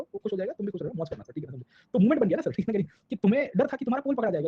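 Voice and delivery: ordinary delivery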